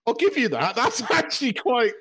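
high-pitched, impressed concession, laughing through it; amused, high mock-impressed voice; acted pleasant surprise. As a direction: play up being won over, pitched well up. Acting out being grudgingly impressed.

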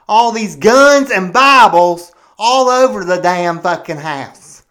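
loud, indignant ranting; outraged rant. Acted. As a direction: boil over about the Bibles everywhere.

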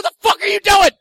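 high, loud frustrated shout; furious passenger yell; exasperated yell. Retold or imitated. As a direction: shout at the dawdling driver. Yelling at slow driver friend.

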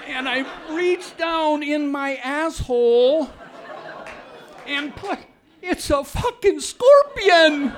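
high, loud and slow, laughing through it; building to a near shout; shocked retelling. Retold or imitated. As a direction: relive the horror half laughing. Telling the sting with rising shock.

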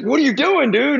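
high, affronted protest; indignant. Retold or imitated. Young addict to laughing old-timer, high affronted protest. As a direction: toss it up high, wounded pride. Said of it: "and like".

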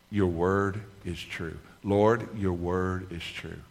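slow, measured declaration; deliberate confession. Shown as a demonstration. Preacher repeats his confession to the Lord, slow and deliberate. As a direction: draw each word out slowly.

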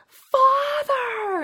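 high, loud mock wail; demon-possessed woman. Demon-possessed woman struggling with a tiny compact, hammed-up high cry. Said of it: "this demon-possessed woman or whatever holding a compact".

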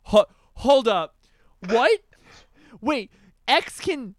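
loud, high, halting astonishment; stunned disbelief. Retold or imitated. Host reacting to the artist screaming, loud stunned halting. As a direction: stop dead between bursts, baffled.

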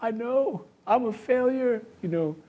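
high, pleading, ashamed voice; pleading sinner. Acted. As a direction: beg it like a broken believer. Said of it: "And we go".

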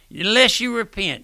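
emphatic loud repeat. Acted. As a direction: hammer the warning up high.

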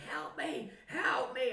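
high, hammed-up cry for help; imitated screaming for help. Imitating injured brother on the beach, screaming for help. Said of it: "he was screaming".

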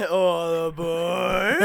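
slow, drawn-out, laughing call of All aboard; laughing train call. Shown as a demonstration. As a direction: sing it out like a train conductor, laughing. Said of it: "give me your best "All aboard".

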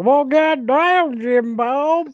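hammed-up slow Southern drawl. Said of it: "We're in Louisiana all of a sudden?".

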